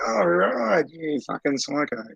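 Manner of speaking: high, shocked sing-song, then sneering; mock horrified local. Retelling locals' reaction to his gun job, mock horrified. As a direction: mimic someone recoiling in disbelief. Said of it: "And I'm like".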